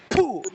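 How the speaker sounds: forceful puff of breath; sharp ritual puff. Real, not acted. Healer sealing an incantation, sharp blown puff. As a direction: blow out the spell in one burst.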